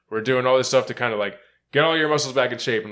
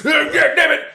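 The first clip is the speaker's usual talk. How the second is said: high, loud mock outburst; mock coach's scream; 6 dB louder than usual. Imitating someone screaming at people, mock yell. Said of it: "scream at people and not swear".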